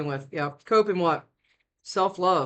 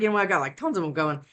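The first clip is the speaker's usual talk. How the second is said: ordinary talk, nothing marked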